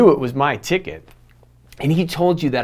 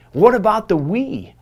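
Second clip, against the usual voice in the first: put-on higher child's voice asking; voicing his young son; 10 semitones higher, 5 dB louder than usual. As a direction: play the little boy pleading for the prize. Son asking as dad leaves.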